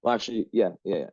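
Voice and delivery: ordinary talk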